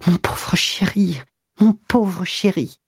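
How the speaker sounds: slow, high, faltering endearment; slow stammered high pity; stammering. A narrator's reading. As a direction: falter over the words, overcome. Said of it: "Elle balbutiait".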